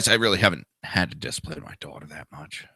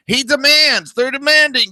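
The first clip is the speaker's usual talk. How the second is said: mock pompous voice pitched far higher; ridiculing, squeaky parody of leaders; mocking whine; 5 dB louder than usual. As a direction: sneer it out in a high, mocking squeal. Mocking world leaders' demands.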